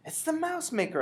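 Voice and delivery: put-on high-pitched child's cry to grandmother; excited cry. A narrator's reading. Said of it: "I cried".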